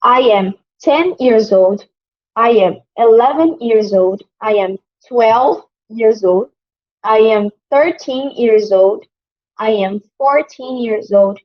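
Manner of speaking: slow, careful teacher's model sentences; slow drill. Shown as a demonstration. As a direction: say each one slowly so students can repeat. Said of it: "Eu vou dar exemplos de dez anos até vinte anos".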